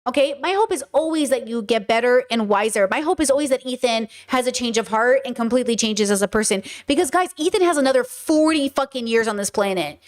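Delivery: ordinary delivery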